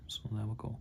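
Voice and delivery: a playful hint of Newcastle accent while painting. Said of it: "Don't know why I'm doing a Newcastle accent".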